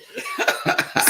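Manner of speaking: very high, squealing giggle; burst of laughter. Real, not acted. Wife laughing as husband corrects her pronunciation, high giggle.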